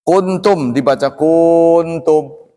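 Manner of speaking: "Kuntum dibaca kuntum" slow, vowel drawn out; wrong length demo. Shown as a demonstration. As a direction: demo the error slowly. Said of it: "Nggak boleh". ("kuntum" dipanjangkan, salah)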